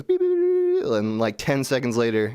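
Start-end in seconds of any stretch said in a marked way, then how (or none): 0.0-0.9 very high machine beeps; imitated computer beeping. AI tool working on a request, high imitated beeps. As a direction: mimic a gadget processing. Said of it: "And it goes".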